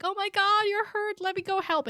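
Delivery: high, loud gushing fake concern; mock Sansa. Friend imitating Sansa's gushing concern, high and loud. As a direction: put on a high sweet worried voice. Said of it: "And Sansa's like".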